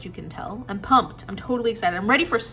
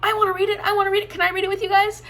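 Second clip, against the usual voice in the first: higher, eager chorus of fans begging to join; eager pleading; 10 semitones higher, faster than usual. Retold or imitated. Viewers eagerly asking to join the read. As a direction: chime in like an excited fan asking to tag along. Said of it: "four or five people were like".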